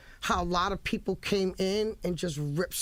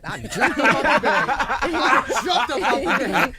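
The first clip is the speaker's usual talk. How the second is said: laughing; 5 semitones higher, 10 dB louder, slower than usual. Real, not acted. Retelling her excited reaction.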